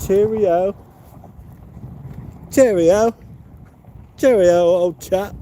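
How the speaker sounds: high, loud mock British "Cheerio, old chap"; posh British. Playing the bird, a hammed-up mock British sign-off. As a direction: ham up a jolly English gent.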